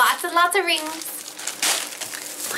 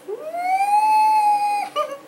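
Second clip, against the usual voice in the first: very high delighted laugh. Real, not acted. Opening a fabric gift, a high giggle. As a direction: laugh with glee.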